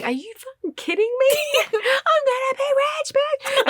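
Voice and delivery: very high, giddy squeal of disbelief; hammed-up thrilled shriek; ecstatic inner screaming. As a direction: act out bursting with private glee. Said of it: "inside of me, I'm, I'm dying, going".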